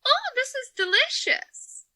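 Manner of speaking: put-on a delighted old man sipping. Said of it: "give it to him".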